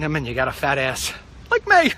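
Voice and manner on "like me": laughing squeal. Real, not acted. Self-mocking squeal.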